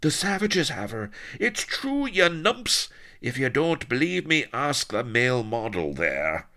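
high, exasperated outburst; urgent insistence. A narrator's reading. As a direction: insist at a high pitch to doubters. Blood insists to doubters, alarmed.

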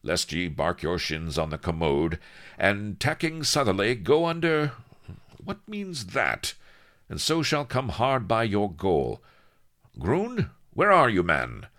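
captain reading archaic directions, pitched high, then calling out; commanding pirate captain. A narrator's reading. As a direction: puzzle through the chart, then holler for your man. Avery reads, calls Groon.

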